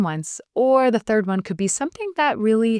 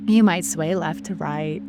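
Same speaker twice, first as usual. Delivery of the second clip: guided meditation. Shown as a demonstration. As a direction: lead the breathing gently, letting each cue hang. Leads a guided breathing practice.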